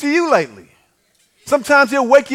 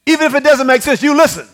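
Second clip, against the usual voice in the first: louder, higher, firm wife's command; firm insisting; 4 semitones higher, 6 dB louder than usual. Retold or imitated. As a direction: lay down the law like a wife. Wife insisting, quoted.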